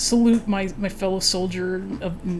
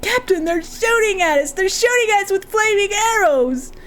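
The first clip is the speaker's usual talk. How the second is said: much higher, frantic shout; panicked yell; 11 semitones higher than usual. Acted. Clerk yelling to the captain under fire, frantic. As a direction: scream the warning in full panic.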